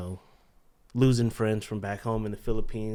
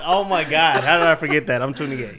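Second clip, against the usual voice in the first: high, loud laughing disbelief; giggly embarrassed correction; laughing at himself; 7 semitones higher, 13 dB louder than usual. Real, not acted. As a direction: crack up at forgetting your own age. Forgets his own age, laughing.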